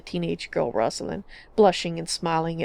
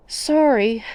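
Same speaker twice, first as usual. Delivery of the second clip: sheepish; 5 semitones higher, 4 dB louder than usual. A narrator's reading. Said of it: "Lywell grinned sheepishly".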